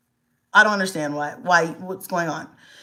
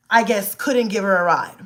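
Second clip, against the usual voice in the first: ordinary talk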